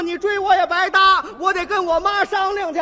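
loud high yell; shouting back. Retold or imitated. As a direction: holler back in a rush. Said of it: "我弟弟说".

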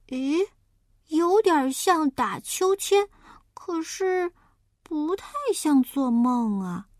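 high, puzzled little pig voice; a small pig wondering aloud; piglet voice. Acted. Piglet talks to himself, puzzled and high.

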